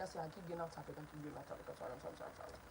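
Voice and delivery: breathy whisper, quick and low; whispered apology. Real, not acted. To viewers after drifting off topic, whispered sorry.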